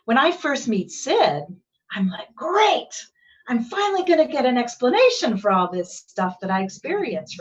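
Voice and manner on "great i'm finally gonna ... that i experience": high, loud burst of eager relief; thrilled retold thought, pitch leaping on "Great"; excited. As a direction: exclaim it with gleeful anticipation. Retelling her own eager thought.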